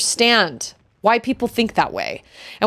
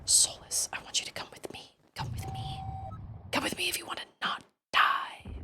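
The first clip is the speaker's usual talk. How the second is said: very soft, secretive whisper; hushed whisper; 16 dB quieter than usual. Acted. Secretly urging Solus to come along, hushed whisper. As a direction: whisper a conspiratorial summons.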